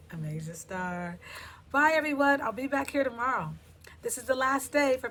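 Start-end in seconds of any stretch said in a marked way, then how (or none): 1.7-3.5 high, loud farewell call; loud sign-off. Real, not acted. Host says goodbye to viewers, loud sign-off. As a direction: call out goodbye to the whole audience.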